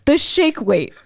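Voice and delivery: high, punched, incredulous delivery; comic emphatic rant. Retold or imitated. Speaker listing silly products to young lady, comically incredulous. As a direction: announce the next absurd example.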